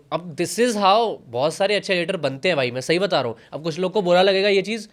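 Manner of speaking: ordinary talk, nothing marked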